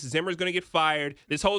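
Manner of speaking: ordinary delivery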